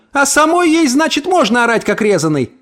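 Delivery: very high, scornful comeback; indignant retort. A narrator's reading. Neighbour accuses the mother of yelling, indignant retort. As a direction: accuse her right back, high-pitched.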